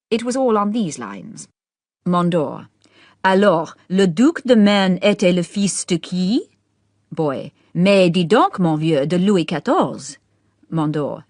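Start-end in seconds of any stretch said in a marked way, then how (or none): 3.2-6.5 loud, slow French question; Mondor quizzing. A narrator's reading. Host quizzing Boy at dinner, loud and slow. 7.7-10.2 loud, slow, showy French answer; Boy showing off. A narrator's reading. Boy showing off to Mondor, loud and slow.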